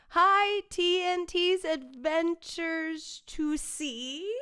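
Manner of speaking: high, stretched-out hello; excited greeting. Real, not acted. Greeting a viewer who joins the stream, excited greeting. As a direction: sing out the name slowly and high.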